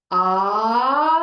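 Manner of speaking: loud ah sliding up and holding the top note; pitch glide up. Shown as a demonstration. Therapist demonstrates glide up, loud rising ah. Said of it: "So you can glide up".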